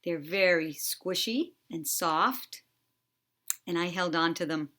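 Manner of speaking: usual speaking voice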